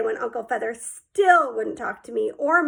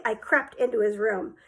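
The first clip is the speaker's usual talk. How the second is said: plain reading voice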